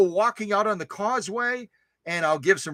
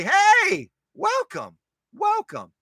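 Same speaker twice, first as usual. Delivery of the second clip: much higher, whooping greeting; excited welcome; 12 semitones higher, much slower than usual. Real, not acted. Host greeting a lurker out of the shadows, excited. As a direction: greet them in a high, delighted pitch. Acted welcome to new chatter.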